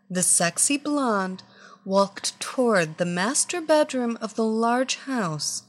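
ordinary delivery, nothing marked

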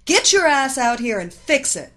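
loud, high-pitched angry tirade; irate customer laying down orders; furious demand. A narrator's reading. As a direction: berate the mechanic, building to a shouted order. Furious customer phones the mechanic.

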